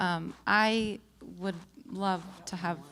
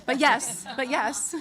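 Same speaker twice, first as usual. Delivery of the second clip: loud, higher, punched affirmation; emphatic yes; 6 semitones higher, 8 dB louder, slower than usual. Real, not acted. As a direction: hit it firmly, louder and higher. Playfully firming up her answer.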